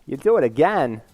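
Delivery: stilted, up-and-down celebrity impression; Christopher Walken. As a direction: menace delivered in odd rises and falls. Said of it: "Okay, Christopher Walken. I can do that one".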